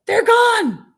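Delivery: put-on high, loud child's wail; wailing kid. Mother voicing her son wailing his pants are gone. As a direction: wail it like a boy about to cry. Son's reply before crying.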